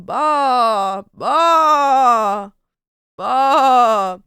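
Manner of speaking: loud bleating bah like a sheep; sheep bleats. Acted. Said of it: "Turning into a sheep".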